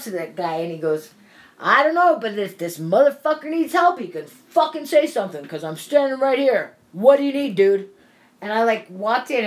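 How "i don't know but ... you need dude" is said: loud, high, furious yelling; angry outburst. Retold or imitated. Jermaine yelling at the silent driver, angry and loud. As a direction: chew the guy out at full volume.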